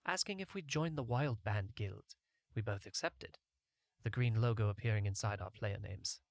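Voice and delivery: plain reading voice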